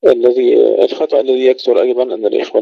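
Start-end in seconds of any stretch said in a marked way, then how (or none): none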